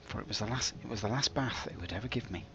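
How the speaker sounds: pompous storybook narration; melodramatic narration. Pretend. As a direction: spin a mock-tragic tale.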